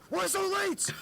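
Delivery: very high mock yelp; hammed-up, extremely high-pitched demand; fed-up teacher insisting. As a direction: imitate a teacher demanding an answer, pitch shot right up. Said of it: "like, pretty fed up".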